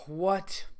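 usual speaking voice

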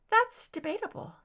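a floating high captor's voice; ethereal soprano. Acted. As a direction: lift it up, otherworldly and cool. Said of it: "higher pitched ... soft soprano".

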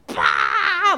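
low, loud barking laugh; a mock belly-laugh bark; bursting laugh. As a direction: explode into laughter.

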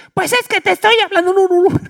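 hammed-up mom answering her son; mother's high retort. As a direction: mimic an indignant mom shouting back. Mother voiced in story.

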